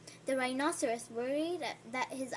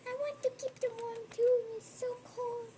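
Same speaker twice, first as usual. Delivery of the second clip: high, quick small animal voice; little penguin; 11 semitones higher than usual. Acted. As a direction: chatter like the little penguin keeping feet warm. Penguin speaks, voiced by reader.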